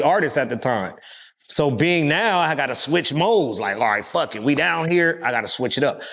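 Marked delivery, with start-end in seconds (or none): none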